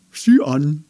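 kauend, grinsend gesprochen; mit vollem Mund. Acted. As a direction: durch die Schokolade hindurch reden. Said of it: "sagte Andi mit vollem Mund und grinste". (Andi spricht kauend zum Nicht-Einhorn)

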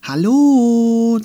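viel höher und lauter rufendes „Hallo?“; drängend. Acted. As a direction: ungeduldig hinüberrufen. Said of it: "drängte Andi". (Andi ruft drängend das Wesen wach)

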